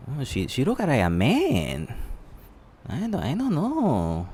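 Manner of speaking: high, soft, slow disbelief; incredulous doubt. Real, not acted. Reacting to a news photo, high and hushed in disbelief. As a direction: sound unsure it's a woman.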